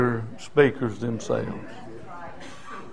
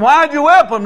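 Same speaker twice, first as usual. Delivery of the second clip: higher, louder challenging question; indignant question; 10 semitones higher, 5 dB louder than usual. Retold or imitated. Preacher confronting parents who whip kids, indignant question. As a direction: demand an answer from the parents.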